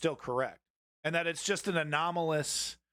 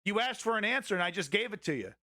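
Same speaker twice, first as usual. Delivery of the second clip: higher, fast, heated telling-off of a mouthy viewer; angry scolding; 4 semitones higher than usual. Real, not acted. Streamer snapping back at a rude chatter, angry and fast. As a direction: put the troll in his place, rapid and hot.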